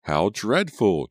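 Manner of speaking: slow, loud, high cry of horror; aghast at all the blood; shocked exclamation. Acted. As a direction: draw out the dismay. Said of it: "Julia declared".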